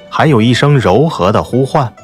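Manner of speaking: ordinary delivery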